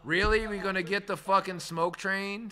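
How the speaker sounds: loud, high mock disbelief; incredulous outburst, hammed up; exasperated retort. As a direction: throw up your hands at the smoky bottle. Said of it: "I was like".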